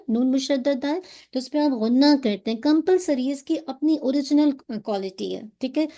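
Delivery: usual speaking voice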